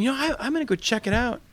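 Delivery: lightly voiced eagerness to explore; eager self. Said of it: "I'm going".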